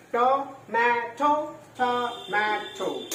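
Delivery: "টমেটো" drawn out as to, ma, to; very slow "টমেটো", every syllable separate; split into syllables. Shown as a demonstration. Teacher telling pupils to practise, "টমেটো" syllable by syllable. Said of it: "তিনটে syllable".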